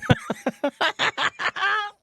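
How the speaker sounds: high squealing laugh; high burst of laughter. Real, not acted. Laughing at the title.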